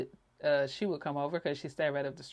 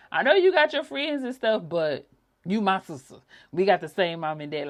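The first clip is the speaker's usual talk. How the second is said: louder, higher sister insisting; sister's voice; 5 semitones higher, 9 dB louder than usual. Retold or imitated. Sister reminding her they share parents, loud and insistent. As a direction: press it home like a big-hearted sister. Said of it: "She used to tell me that".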